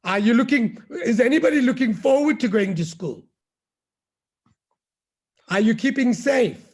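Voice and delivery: ordinary delivery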